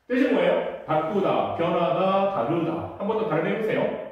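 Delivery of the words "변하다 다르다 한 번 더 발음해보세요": ordinary talk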